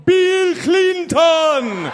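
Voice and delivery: high, loud, very slow bad Jamaican accent. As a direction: proclaim the name slowly in a bad Jamaican accent. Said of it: "I gotta do a Jamaican accent".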